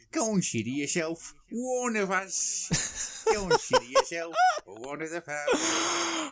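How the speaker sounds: a mock Geordie thief boss, drawn out; Geordie accent. Imitating Andy as a Geordie gang boss, laughing Geordie. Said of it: "his little Geordie accent".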